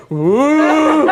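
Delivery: high, loud, drawn-out whoa; loud amazed whoa from the crowd side. Acted. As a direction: gasp out a long wondering whoa. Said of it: "he's like".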